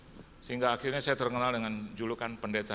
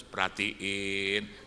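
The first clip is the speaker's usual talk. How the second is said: very slow emphatic word; wife slowly telling him to look closely; slow drawl; 2 semitones lower, much slower than usual. Retold or imitated. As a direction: stretch it out, pointing at the tag.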